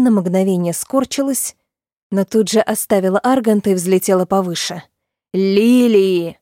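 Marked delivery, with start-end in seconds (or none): 5.3-6.4 loud roared name, slightly louder; dragon roar. A narrator's reading. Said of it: "взревел дракон".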